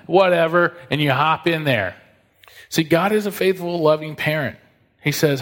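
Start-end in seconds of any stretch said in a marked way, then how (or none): 0.1-0.7 loud, higher brush-off; dismissive whatever. Retold or imitated. Pastor mocking a careless hairdryer user, loud dismissive. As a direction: toss it off like you don't care. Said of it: "you're like".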